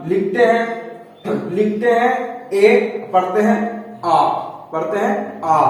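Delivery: slow, spelled-out contrast of ए and आ; slow teaching demo. Shown as a demonstration. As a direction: dictate it slowly so students can follow. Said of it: "लिखा रहता है एन और पढ़ा रहता है अन".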